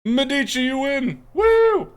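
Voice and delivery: high, loud winner call with a whoop; excited announcement. Real, not acted. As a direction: sing out the winner's name and cheer. Announcing giveaway winner to viewer.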